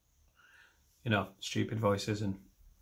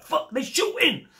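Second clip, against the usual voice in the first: high, loud, drawn-out yell of alarm; panicked shout; 5 dB louder than usual. Acted. Villager hearing gunshots on the way to the post office, panicked shout. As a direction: yell a mock-panicked warning.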